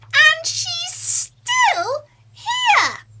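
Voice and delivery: very high, loud crying shout of a cub; wailing Baby Bear. Acted. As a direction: cry out like a little bear who found an intruder. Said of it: "cried Baby Bear".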